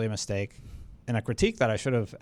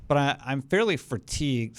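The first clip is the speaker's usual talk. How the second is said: ordinary talk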